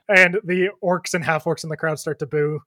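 ordinary talk

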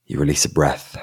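usual speaking voice

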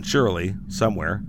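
normal talking voice

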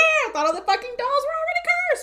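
high, loud, indignant yelling; loud shriek of comic disbelief; exasperated outburst. Real, not acted. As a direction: blurt it out, voice shooting way up. Exasperated outburst at the plot contradiction.

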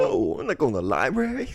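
mock Italian-American guy. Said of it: "Italian bit continues".

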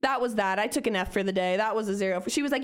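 ordinary talk

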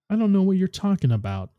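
coolly. A narrator's reading. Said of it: "Amaru said coolly".

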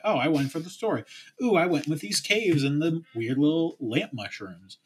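excited. Pretend. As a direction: gush like a player showing off a discovery. Imagined excited player quote.

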